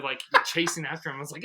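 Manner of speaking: ordinary talk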